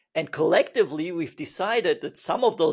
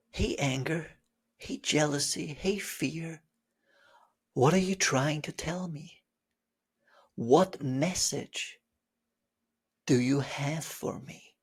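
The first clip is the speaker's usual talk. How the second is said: gentle asking; 3 semitones lower, 4 dB quieter than usual. Pretend. Student gently asking emotions for messages. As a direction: ask the feelings kindly.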